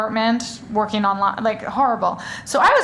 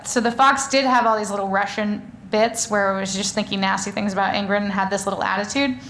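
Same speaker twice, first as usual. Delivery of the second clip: ordinary talk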